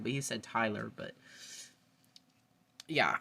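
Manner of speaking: ordinary talk, nothing marked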